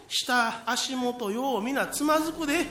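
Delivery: ordinary delivery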